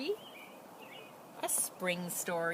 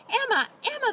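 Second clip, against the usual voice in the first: much higher, louder breathless calling; farmer's wife calling, panting; panted; 8 dB louder than usual. Acted. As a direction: call her name out of breath after the chase. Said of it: "the farmer's wife panted".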